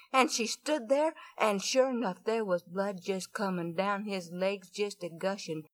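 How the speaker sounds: ordinary delivery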